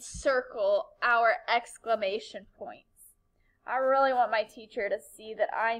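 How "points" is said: whispered. Real, not acted. Trailing word at circle instruction.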